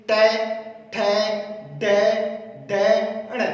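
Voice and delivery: टै, ठै, डै hit loud and separate; demonstrating sounds. Shown as a demonstration. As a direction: say each letter firmly, pause between. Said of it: "टै बोलकर देखिए".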